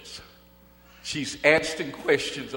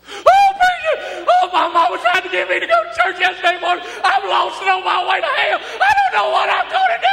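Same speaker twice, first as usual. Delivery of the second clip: high, loud, wailing sobs, near hysterical; total meltdown; 15 dB louder, faster than usual. Retold or imitated. Teller breaking down to the preacher about hell, wailing sobs. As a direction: break down crying and shouting at once. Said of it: "She had a total meltdown in the box".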